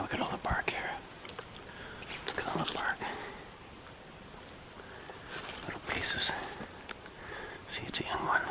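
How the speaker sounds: hushed whisper near the shelter. Real, not acted. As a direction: whisper so nothing hears. Sneaking near sasquatch structure.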